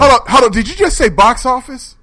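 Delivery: high, loud mock outrage; mock shock. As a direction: call him out playfully.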